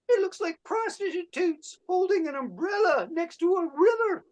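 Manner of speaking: a coy painter proud of his dots; Georges Seurat. Acted. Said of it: "here is my impression of Georges Seurat".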